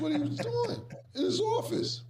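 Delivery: words breaking into high laughter; laughing. Real, not acted. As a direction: laugh it out, can't hold it.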